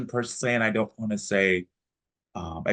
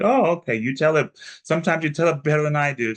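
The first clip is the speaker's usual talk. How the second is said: high, lively mock enthusiasm; imitating Jonathan; 6 semitones higher, 4 dB louder, faster than usual. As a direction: rattle it off fast and eager. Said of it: "He was just like".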